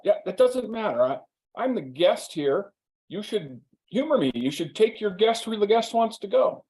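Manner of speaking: lightly voiced entitled guest; entitled insistence. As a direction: play the pushy guest who must have his way.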